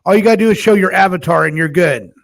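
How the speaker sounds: ordinary talk, nothing marked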